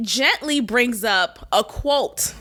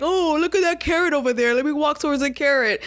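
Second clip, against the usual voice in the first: dupe voice; 3 semitones higher, faster than usual. Pretend. Mocking gullible people as dupes.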